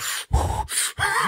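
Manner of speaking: heavy puffing breaths, holding back laughter; acted-out stifled-laugh breathing. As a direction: puff hard to keep from laughing. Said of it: "like, breathing heavy".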